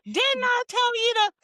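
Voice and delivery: high, slow scolding mama. Retold or imitated. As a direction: nag like a strict mother. Scolding mother voice.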